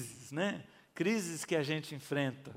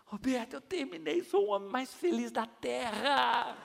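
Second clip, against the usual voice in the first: high, gushing relief; overflowing with happiness; overjoyed; 7 semitones higher than usual. Retold or imitated. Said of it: "transbordando assim". (alegria transbordante, aguda)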